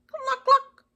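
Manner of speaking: very high, loud clucks; chicken cluck. A narrator's reading. Chicken answering Duck as he rides towards her, chicken cluck. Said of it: "said Chicken".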